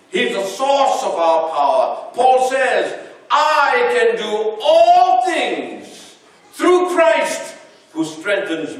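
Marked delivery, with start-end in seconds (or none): 3.3-8.8 preaching proclamation. Acted. Preacher proclaims scripture verse.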